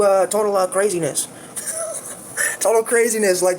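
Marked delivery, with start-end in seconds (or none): 1.6-2.1 laugh. Real, not acted. Laughs at the memory.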